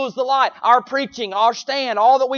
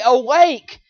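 ordinary talk

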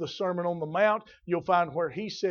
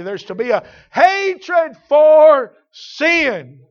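loud, high, drawn-out preacher's cry; impassioned pulpit shout, stretching the key words; fervent preaching; 7 semitones higher, 15 dB louder, slower than usual. Real, not acted. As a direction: preach it slowly at full force. Preaches at full force.

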